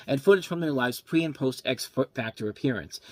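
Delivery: ordinary delivery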